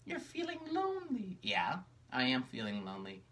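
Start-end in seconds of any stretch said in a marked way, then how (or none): 0.1-1.4 very high, gentle puppet reassurance; caring high puppet. Acted. Charcoal reassures sad Magenta, very high and kind. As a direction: comfort her as a sweet little puppet.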